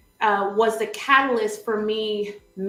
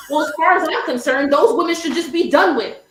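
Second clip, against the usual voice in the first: loud, higher mock tirade; hammed-up indignant panelist; ruthless rant; 5 semitones higher, 4 dB louder than usual. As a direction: lay down the verdict on those women. Acting out Betsy's ruthless answer.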